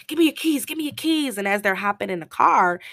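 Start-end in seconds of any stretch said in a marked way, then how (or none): 0.1-1.4 high, loud urgent demand. Retold or imitated. Caladro urgently demanding Jane's keys, high and loud. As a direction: rush him for the keys.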